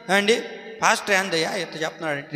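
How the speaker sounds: low, soft grumbling aside; grumbling listener. Retold or imitated. A churchgoer grumbling about the pastor's sermon, low and muttered. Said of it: "కొంతమంది చూడండి".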